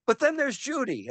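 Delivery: very high-pitched, rapt exclamation; retold reply soaring far above normal pitch. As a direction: send the voice way up high. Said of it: "I went way up high".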